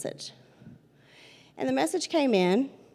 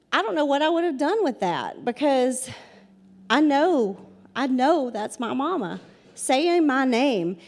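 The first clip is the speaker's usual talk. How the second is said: ordinary delivery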